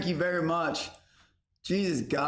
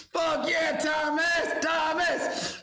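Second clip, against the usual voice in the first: hype shout; 10 semitones higher, slower than usual. Real, not acted.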